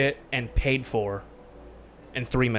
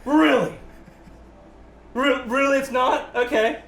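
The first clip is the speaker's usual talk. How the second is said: sarcastic disbelief; 10 semitones higher than usual. Retold or imitated. Answering his dad's claim, high sarcastic disbelief. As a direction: throw it back sarcastically at dad. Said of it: "my dad goes".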